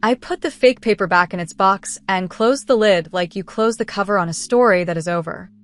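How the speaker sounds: plain reading voice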